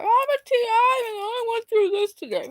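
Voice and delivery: high mock whine; mocking whiny voice. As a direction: parody a whiny complainer. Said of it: "I don't wanna continue to be like".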